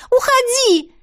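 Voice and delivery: high, loud desperate scream; girl shrieking at the dragon to leave. A narrator's reading. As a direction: scream it with hands over your ears. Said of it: "кричу я".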